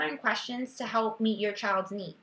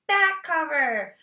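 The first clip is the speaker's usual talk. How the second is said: put-on child's answer; 7 semitones higher, 6 dB louder, slower than usual. Teacher voicing child's answer.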